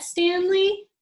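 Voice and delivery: high loud dad calling; father calling up. Acted. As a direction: call up to the boy on the rack. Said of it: "he asked".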